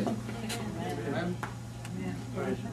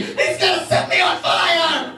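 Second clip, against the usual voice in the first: mock panicked scream; 7 semitones higher, slower than usual. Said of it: "I was gonna run out there screaming".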